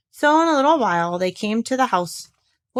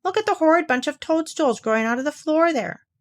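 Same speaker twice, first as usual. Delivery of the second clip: Kanga, cross; 7 semitones higher than usual. A narrator's reading. Kanga scolding Owl about his house.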